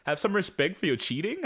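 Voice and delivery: mock-indignant question, rising at the end; incredulous retort. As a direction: imitate someone scoffing in disbelief. Adam throws the words back.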